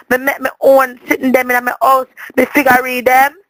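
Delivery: ordinary talk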